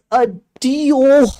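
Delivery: a deal in a loud American accent. Shown as a demonstration. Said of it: "American accent is a deal".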